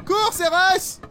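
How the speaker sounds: high loud panicked shout. Real, not acted. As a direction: yell it out in panic. Panicked in game.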